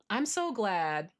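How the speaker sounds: ordinary talk, nothing marked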